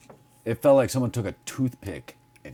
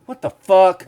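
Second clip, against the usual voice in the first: sudden louder disbelief; loud mock outrage; 6 semitones higher, 9 dB louder than usual. Man finding he took children's medicine, loud disbelief. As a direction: bark it in disbelief.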